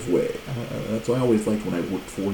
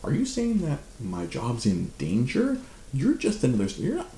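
high indignant retort to the coworker; mock outrage; 11 semitones higher than usual. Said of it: "I'm like".

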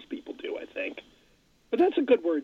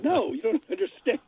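lightly voiced dad; 11 semitones higher than usual. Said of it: "my dad said".

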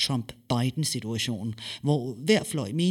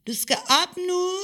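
high, louder mother's voice calling; 4 dB louder than usual. Acted. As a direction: wake her up from the kitchen, high. Mother calls daughter. (høj, kaldende morstemme)

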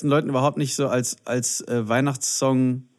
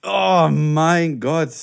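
drawn-out oh my God; 3 semitones higher, 4 dB louder, much slower than usual. Real, not acted. Reacts to spoiler.

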